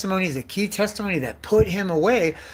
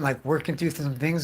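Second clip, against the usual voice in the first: normal talking voice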